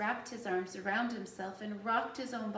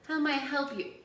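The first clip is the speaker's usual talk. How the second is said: higher, louder mock-helpful question; sarcastic mimicry; 5 semitones higher, 6 dB louder than usual. A narrator's reading. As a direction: parody the eager attendant, loudly. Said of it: "Jonas mimicked in a cruel, sarcastic voice".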